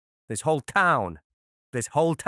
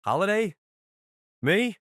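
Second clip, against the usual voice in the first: high-pitched incredulous questions; startled disbelief; 9 semitones higher, 4 dB louder than usual. Acted. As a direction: protest in surprise as the accused. Mob boss startled by the accusation.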